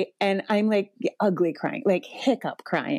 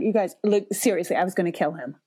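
normal talking voice

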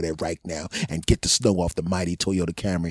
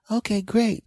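put-on pitched-up eager kid; high kid voice. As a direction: chirp it like a relieved kid. Kids voiced.

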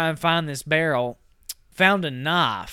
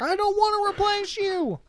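mock protest. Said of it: "Margot's like".

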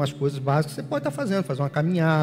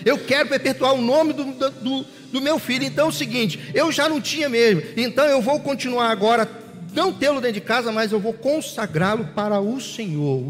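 high-pitched, insistent vow to God; impassioned praying woman; 7 semitones higher, 3 dB louder than usual. Acted. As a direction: plead her case fervently, pouring out the promise. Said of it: "porque ela tinha prometido".

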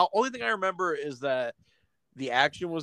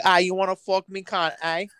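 higher, hammed-up British accent; mock British woman; 4 semitones higher than usual. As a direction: imitate a brash British woman. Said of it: "some very busty British woman".

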